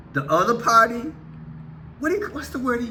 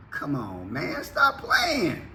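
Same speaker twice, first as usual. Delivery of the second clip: 1 exasperated; 6 semitones lower, 4 dB quieter, slower than usual. Real, not acted. Exasperated catchphrase.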